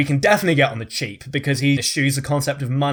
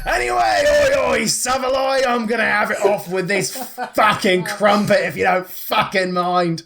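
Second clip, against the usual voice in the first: rowdy shout; 7 semitones higher than usual. Acted. Crude rich dad announces himself, rowdy mock shouting. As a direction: holler it like a rowdy geezer.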